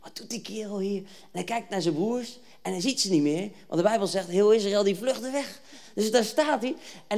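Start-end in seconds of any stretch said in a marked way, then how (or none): 0.0-1.0 inner thought. Acted. David turning round towards the cursing giant, hushed thought. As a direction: think it aloud under your breath. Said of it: "hij denkt".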